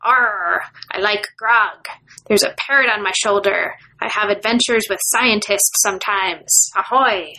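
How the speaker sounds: hammy pirate talk, lightly done. Pretend. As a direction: growl it out like a cartoon pirate. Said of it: "why I'm talking like a pirate".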